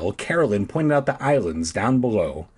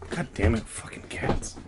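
unvoiced annoyed under-breath; muttering; 7 dB quieter than usual. Real, not acted. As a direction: grumble it under your breath. Annoyed at cat.

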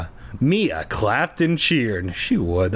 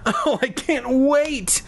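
high, drawn-out squeal of glee; gleeful; 12 semitones higher, slower than usual. A narrator's reading. Mia about to learn flying, gleeful. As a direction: squeal it, stretching the words. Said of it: "Mia jumped with glee".

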